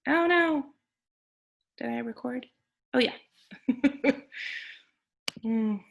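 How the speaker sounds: normal talking voice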